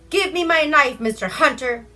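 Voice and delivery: high, loud pleading plea from the raven; begging raven. Acted. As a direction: beg for the knife back, desperately. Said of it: "begged the raven".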